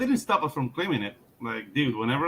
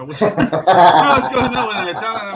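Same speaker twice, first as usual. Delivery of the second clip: laughing; 7 semitones higher, 10 dB louder than usual. Real, not acted. Joking line delivered while laughing.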